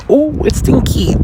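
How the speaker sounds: high, slow, lightly voiced squeamish "ooh"; a hint of a grossed-out squeal; mock disgust. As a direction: wrinkle your nose at the smell, slowly. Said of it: "you get a little".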